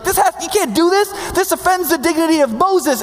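high, indignant outburst; mock-outraged high voice; angry Joshua. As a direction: protest like an offended loyal aide. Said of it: "He's angry".

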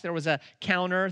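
ordinary talk

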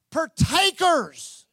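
"Partakers" proclaimed very slowly, every syllable held; drawn-out emphasis. Real, not acted. Preacher answering his own question to the church, drawn-out emphasis. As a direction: stretch "Partakers" out long and firm.

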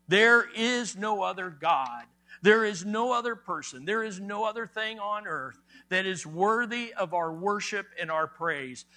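ordinary talk, nothing marked

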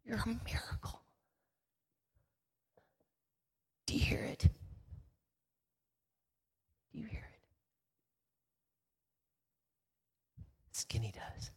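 soft, moved whisper. Real, not acted. Preacher echoing God's words to the crowd, whispered. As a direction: hush it to the audience.

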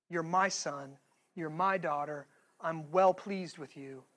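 quiet tender affirmation; tender father. Retold or imitated. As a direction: speak it like a loving father to his child.

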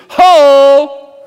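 loud, high shouted call; prophet's cry. Acted. Preacher voicing Isaiah's call, loud shout.

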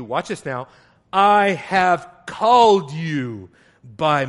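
loud, slow, declaiming reading; preaching scripture. Acted. Preacher reading God's promise to Jacob, slow and loud. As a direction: proclaim each promise slowly.

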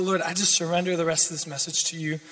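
ordinary talk, nothing marked

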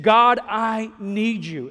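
loud, slow plea to God; crying out. Retold or imitated. Pastor re-enacting his own prayer when overwhelmed, crying out. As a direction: cry out for help, drawing the words out. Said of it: "just sit there and cry out".

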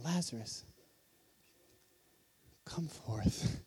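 soft, low and far-off, with no force; timid quiet call. Shown as a demonstration. The weak way many imagine Jesus calling into the tomb, hushed. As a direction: call him out meekly, almost a murmur. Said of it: "many of us look at this and see Jesus saying".

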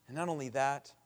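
ordinary talk

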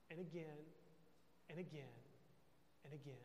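paused, dwindling repetition; relentless quiet accusation. Acted. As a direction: rub the guilt in, letting each repeat sink. Devil's accusation repeated, fading away.

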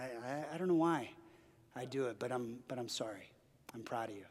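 a father humbly saying sorry; hushed, sincere confession of pride; tender apology. Retold or imitated.

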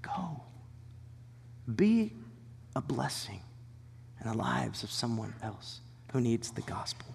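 God's soft whisper; hushed divine urging; whispered prompting. Pretend. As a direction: whisper it like a quiet nudge. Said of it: "God's prompting you and saying".

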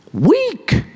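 squeaked mock high on "weak.". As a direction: pitch "weak." up high and hold it thin. Mock answer, spiked high.

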